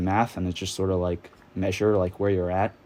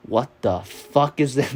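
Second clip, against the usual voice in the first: angry dad; 4 semitones higher than usual. Retold or imitated. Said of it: "He was like".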